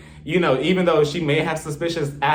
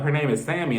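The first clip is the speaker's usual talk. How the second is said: ordinary talk, nothing marked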